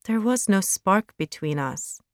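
usual speaking voice

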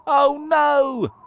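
high, loud cry of alarm; acted dismay. A narrator's reading. Friends learn cloud men are near, alarmed. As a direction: cry out in storybook dread.

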